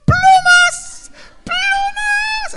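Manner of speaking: very high, loud, frantic screams of plumas; panicked woman yelling plumas at robbers; shrill screaming. Retold or imitated. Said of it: "comenzó a gritar".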